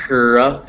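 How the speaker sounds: R exaggerated as "rruh", with air pushed into it; breathed-into R. Shown as a demonstration. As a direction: really breathe into the R sound. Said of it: "if you kind of breathe into it".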